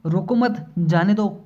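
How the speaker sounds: "रोको मत" together, a break, then "जाने दो"; group "रोको मत" as one, then release "जाने दो"; pause after मत. Shown as a demonstration. Teacher showing how the thief gets away, pause after "मत". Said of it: "इन चिन्हों का प्रयोग ना करके बोले".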